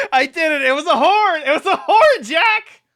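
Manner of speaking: gleeful victory yell with laughter; triumphant shout. Real, not acted. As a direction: yell it out like you just won. Triumphant outburst at friend Jack.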